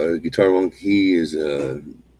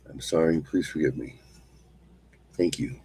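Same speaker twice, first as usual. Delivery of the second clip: quiet mantra repeated softly; mantra recitation; 4 dB quieter than usual. Acted. Teacher repeating the mantra as an example, soft. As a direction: recite gently, letting it fall.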